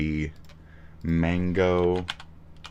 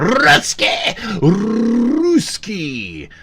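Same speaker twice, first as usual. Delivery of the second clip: much higher, louder shriek of Rusky; hammed-up yell; 6 dB louder, much slower than usual. Shown as a demonstration. Streamer yelling at a troll in chat, hammed-up shriek. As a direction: screech Rusky over the top. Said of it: "I'm supposed to say it like that".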